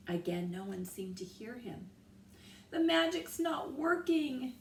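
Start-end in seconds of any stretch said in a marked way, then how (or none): 2.7-4.5 high, loud worried call; anxious cry. A narrator's reading. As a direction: cry out to your brother. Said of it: "Annie cried to Jack".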